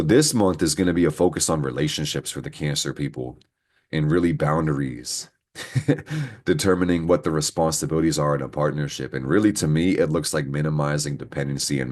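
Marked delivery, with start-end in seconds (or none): 5.6-6.4 laugh. Real, not acted. Laughing during astrology reading.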